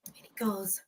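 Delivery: soft unvoiced sigh before the quote; a hushed sigh of hesitation; whispered sigh. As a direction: breathe out a sigh under the breath. Son pauses, sighs.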